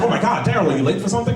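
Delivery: high-pitched imitation of a worried woman; startled woman. Woman in bed questions shouting man, high and startled. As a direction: ask in a high, mock-alarmed voice. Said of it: "she was like".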